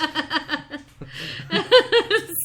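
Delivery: a burst of high real laughter. Real, not acted. Laughing at the five words you need, a real high laugh. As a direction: laugh out loud at the joke.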